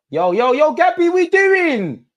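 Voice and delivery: high, loud yell at Gabriel; shouting at the screen. Real, not acted. Fan yells at Gabriel through the TV, high and loud. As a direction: call out to the player like he can hear.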